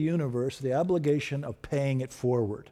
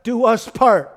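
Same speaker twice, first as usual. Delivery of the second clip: fervent bride's vow; 7 semitones higher, 8 dB louder, slower than usual. Acted. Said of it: "she says".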